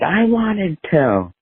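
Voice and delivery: high, slow, petulant mock reply; sulky brother. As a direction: drag it out like a sulking kid. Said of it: "He's like".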